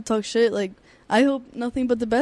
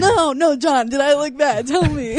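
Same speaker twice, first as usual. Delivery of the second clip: higher, louder and slower, begging for the truth; anxious pleading; 3 semitones higher, 5 dB louder, slower than usual. Retold or imitated. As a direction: plead with him like a worried fighter. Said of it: "I'll be like".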